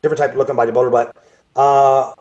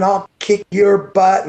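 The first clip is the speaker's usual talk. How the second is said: slow, higher mock shouted threat; a retold angry threat, hammed up a little; imitated yell; 5 semitones higher, much slower than usual. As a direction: replay the guy yelling at him, half-hearted. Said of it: "and screaming".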